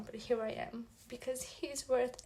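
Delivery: fighting back real tears; crying despite herself on camera; tearful. Real, not acted. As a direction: voice breaking into tears mid-sentence. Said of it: "I promised I didn't cry on camera".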